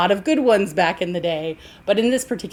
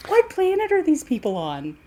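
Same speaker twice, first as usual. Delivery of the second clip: higher, laughing disbelief; incredulous laughing; 8 semitones higher than usual. Real, not acted. Repeats question, laughing in disbelief.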